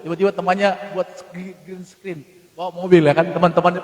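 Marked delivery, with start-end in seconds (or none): none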